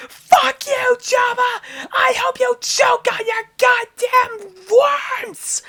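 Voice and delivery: very high, screeching fury; screamed curse. Acted. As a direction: shriek abuse up from the pit. Captive curses Jabba from the pit.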